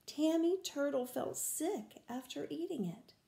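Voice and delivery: ordinary delivery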